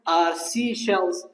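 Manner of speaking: very slow, stretched-out words; slow model reading. Shown as a demonstration. Teacher continues the tongue twister for students, slow model reading. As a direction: draw each word out for the learners.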